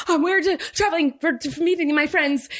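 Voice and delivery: very high, stammering nervous lie; hammed-up guilty panic; panicked person. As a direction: squeak out a botched cover story. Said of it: "I'm, like, a panicked person".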